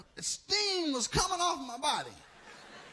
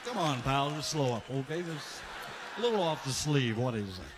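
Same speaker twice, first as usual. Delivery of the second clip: put-on much lower, gruff reply to the drunk; deep mock voice; 9 semitones lower than usual. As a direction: drop way down like a burnt guy. Burning man answers the drunk.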